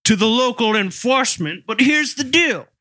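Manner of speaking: high, put-on shopkeeper, shrugging it off; shopkeeper's voice. Host voices the pet shop owner excusing the daily bunny sales, high and put-on. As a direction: play the rabbit seller who sees no problem.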